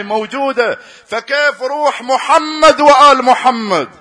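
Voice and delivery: high, loud impassioned sermon; fiery preaching. Acted. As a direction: preach it at full force, pleading. Preaching at full force.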